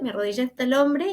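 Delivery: ordinary talk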